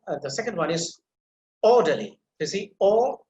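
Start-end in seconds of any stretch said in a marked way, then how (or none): 1.6-2.2 orderly with a loud, punched OR; stress on first syllable. Shown as a demonstration. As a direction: hit the O of orderly, lighten the rest. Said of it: "that is emphasized".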